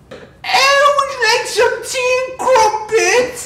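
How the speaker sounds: very high, loud British granny; old British woman. Acted. Host offers tea and crumpets, old British woman voice. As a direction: screech it out slowly like an old British lady. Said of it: "that's my British, old British woman accent".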